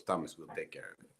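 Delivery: very soft, low, quick murmur; hushed, unfussed assurance; calm reassurance. Retold or imitated. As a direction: imitate him quietly promising to handle it. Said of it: "he said it so calmly".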